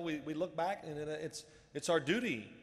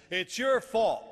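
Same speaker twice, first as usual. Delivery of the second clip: high, loud retold coach's accusation; emphatic accusation; 7 semitones higher, 10 dB louder, much slower than usual. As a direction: hammer the blame home, much louder. Coach repeats the blame louder.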